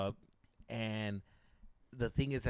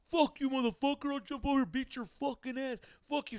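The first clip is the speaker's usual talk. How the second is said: high-pitched heckler rant, volume held back; mock yell threats from the railing; imitated angry yelling; 10 semitones higher than usual. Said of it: "he was yelling at him".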